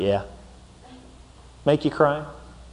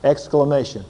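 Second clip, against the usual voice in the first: usual speaking voice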